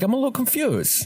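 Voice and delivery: mock puzzled dad; imitating his father's warning line; dad's phrase. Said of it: "He starts with, like".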